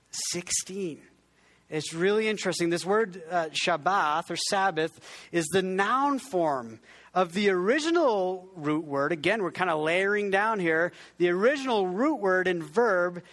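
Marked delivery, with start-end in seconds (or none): none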